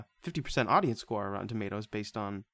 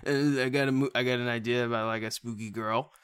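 ordinary delivery

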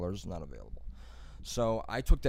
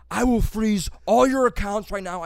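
slow, much higher and louder furious shout; screaming threat; 10 semitones higher, 11 dB louder than usual. Retold or imitated. Scam caller screaming threats back, slow and furious. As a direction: roar the threat down the phone. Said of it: "He was fucking screaming at me".

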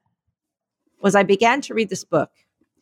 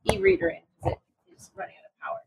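leaning away; 5 semitones higher, 8 dB quieter, faster than usual. Real, not acted. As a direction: voice drifting off mic, much softer. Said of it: "gonna lean over here a little bit".

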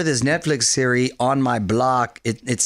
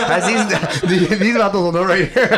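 laughing; 5 semitones higher than usual. Real, not acted. Laughing during podcast banter.

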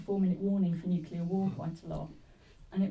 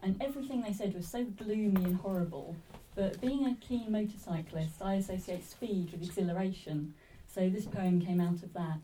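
ordinary delivery

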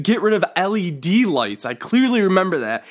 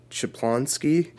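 slow, soft, savored; 5 dB quieter, slower than usual. Pretend. Invented silly word offered.

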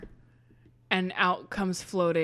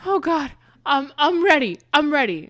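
excited surrender; 8 semitones higher, 10 dB louder than usual. Acted. Rhonda greeting God in clouds.

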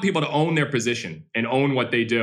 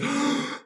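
put-on kid's gasp; 13 semitones higher, 3 dB quieter than usual. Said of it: "he goes".

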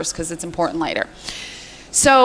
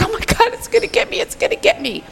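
1 panicked; 13 semitones higher than usual. Retold or imitated. Fleeing clown, retold panic.

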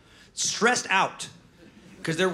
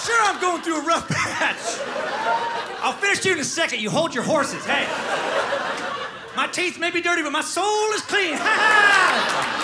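high-pitched, defiant ragged bum; proud homeless man; 12 semitones higher than usual. Acted. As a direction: brush off the devil with pride. Homeless man rejects devil.

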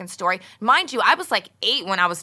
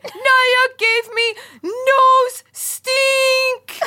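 high, loud, slow wailing sob; imitated crying child; 5 dB louder, much slower than usual. Little girl crying to mom in the car, high slow wail. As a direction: wail it like a hysterical four-year-old. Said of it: "And Stevie's like".

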